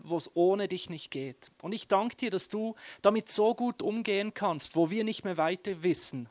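ordinary talk, nothing marked

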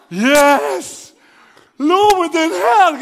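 loud, high, drawn-out cheer; mock jubilation. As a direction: shout praise like a triumphant believer. Jubilant thought on seeing Boris Becker. (lauter, übertriebener Jubelschrei)

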